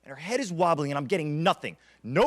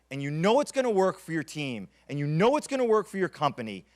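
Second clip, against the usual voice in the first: usual speaking voice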